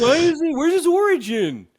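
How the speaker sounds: nagging fanboy demanding backstory; whiny fan complaint. Retold or imitated. Mocking fans who demand an origin story, high whine. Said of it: "shut everybody up".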